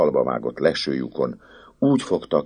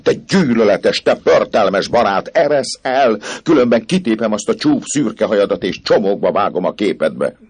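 high, loud, furious outburst; furious girl; 9 semitones higher, 9 dB louder than usual. Acted. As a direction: spit insults at him. The girl curses the priest. (dühös, magas hangú lány kiabál)

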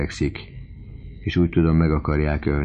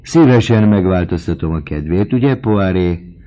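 ordinary delivery, nothing marked

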